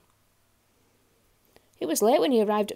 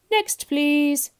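loud, high call; booming dismissal; bellowing greengrocer; 6 semitones higher, 8 dB louder than usual. A narrator's reading. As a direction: bellow for the next customer. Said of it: "bellowed the greengrocer".

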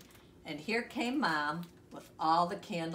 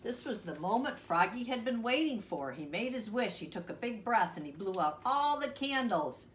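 usual speaking voice

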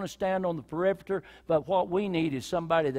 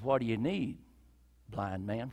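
softer, lower, kind question; gentle question; 8 semitones lower, 6 dB quieter than usual. Acted. Jesus gently asking the blind man, soft and kind. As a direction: ask him tenderly.

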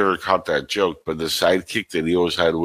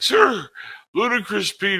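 high mock sidekick voice; 12 semitones higher than usual. Quoting Spaceballs characters.

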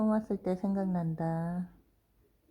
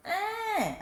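surprised squeal; 13 semitones higher than usual. Real, not acted. Surprised at dog.

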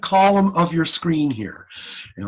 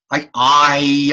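ordinary delivery

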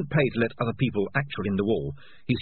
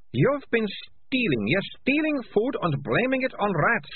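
put-on high, slow, accusing girl's voice; snapping girl; 13 semitones higher than usual. A narrator's reading. Malicia accusing the rat catchers, high snapping voice. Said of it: "snapped Malicia".

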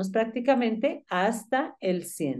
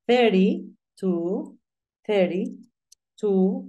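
"thirty-two" drawn out very slowly, th then tapped t; slow demo; much slower than usual. Shown as a demonstration. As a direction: stretch "thirty-two" syllable by syllable for learners. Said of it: "estábamos que se dice".

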